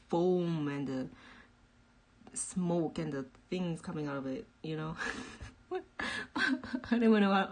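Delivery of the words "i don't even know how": laughing. Real, not acted. Laughing at own order.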